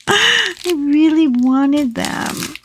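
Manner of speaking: drawn-out whine, slow; childish whine. Pretend. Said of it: "nine-year-old".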